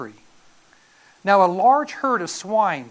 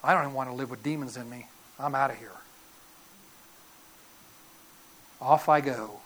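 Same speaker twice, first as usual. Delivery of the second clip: low, soft deadpan pig bailing out; quietly resigned mock pig; 7 semitones lower, 4 dB quieter, faster than usual. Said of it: "The pegs are going".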